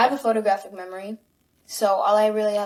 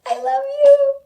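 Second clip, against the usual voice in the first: much higher, louder, slow gushing; gushing love; 9 semitones higher, 9 dB louder, slower than usual. Acted. Celebrity to fan, high loud gushing. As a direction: gush it like an overwhelmed fan. Over-the-top declaration.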